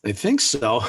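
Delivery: chuckling with relief; gleeful laugh. Acted. Father gloating with his friend, gleeful laugh. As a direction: laugh at the plan working.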